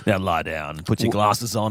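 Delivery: deep, hammed-up calm radio intro; mock soothing host. Mock relaxation host again.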